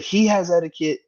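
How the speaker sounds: high, mocking whiny voice of the angry dad. Retelling the golf dad yelling at him, high mock whine. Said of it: "He's like".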